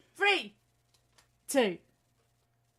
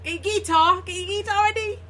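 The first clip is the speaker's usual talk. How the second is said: loud hype; 8 semitones higher, 6 dB louder, faster than usual. Real, not acted. Hyping the song.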